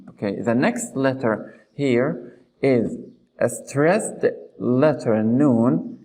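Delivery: ordinary talk, nothing marked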